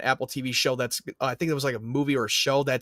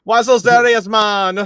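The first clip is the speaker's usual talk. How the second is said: put-on very loud, high Jamaican accent; 7 semitones higher, 9 dB louder, slower than usual. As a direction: bellow it as a Jamaican villain. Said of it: "if [name] w-, went psycho".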